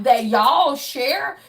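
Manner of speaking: ordinary delivery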